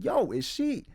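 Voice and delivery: jolted by what you just heard; shocked. Retold or imitated. Viewer reacting to the phone scream, high alarmed shock.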